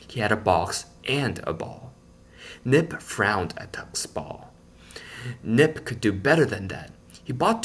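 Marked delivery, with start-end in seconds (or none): none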